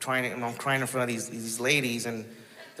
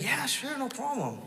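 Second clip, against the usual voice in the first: much higher, airy church-lady voice; pitched way up, mock sweet yes; church lady agreeing; 14 semitones higher than usual. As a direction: chirp it back like a cheerful church lady.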